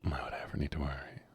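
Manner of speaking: hushed late-night whisper; soft whisper so no one wakes. Real, not acted. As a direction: keep it under your breath.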